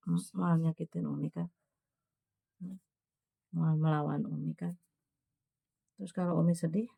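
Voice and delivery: soft, hushed, gentle coaxing; a mother softly gives her youngest son a pep talk before school; tender motherly advice. Real, not acted.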